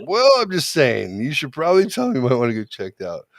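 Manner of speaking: acted-out defensive friend. Friend defends herself in story.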